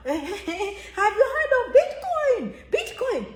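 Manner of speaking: high, slow, laughing mock voice; hammed-up naive question; mock latecomer. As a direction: parody the clueless latecomer. Mocking latecomers.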